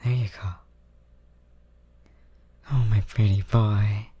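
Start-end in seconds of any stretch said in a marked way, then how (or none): none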